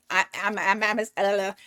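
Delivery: stammering over the first word; tongue-tied stutter. Real, not acted. Tarot reader voicing the client's reply, tongue-tied stutter. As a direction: trip and restart, getting stuck on "I'm". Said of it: "fucking stuttering and shit".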